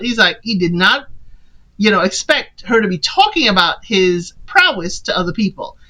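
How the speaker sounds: normal talking voice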